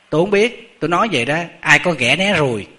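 scolding. Retold or imitated. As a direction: imitate a cranky woman telling someone off. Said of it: "bà nạt luôn thầy á".

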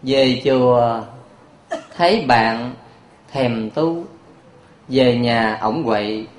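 slow playful couplet; poem recitation. Shown as a demonstration. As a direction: recite the altered verse slowly and quietly. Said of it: "có sửa lời một chút".

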